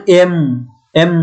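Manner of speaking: এম with a hummed m through the nose; demo nasal m. Shown as a demonstration. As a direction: close the lips and hum এম. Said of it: "নাক দিয়ে বাতাস বের হচ্ছে". (এম বলতে নাক দিয়ে গুনগুন)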